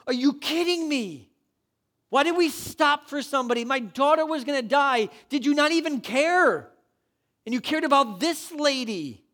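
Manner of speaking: loud, high, outraged protest; indignant father. Acted. Ruler protesting to Jesus after the delay, loud outrage. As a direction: hurl it at Jesus in disbelief. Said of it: "the emotions that this man must have had".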